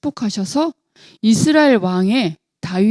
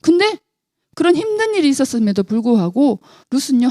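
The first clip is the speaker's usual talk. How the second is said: ordinary delivery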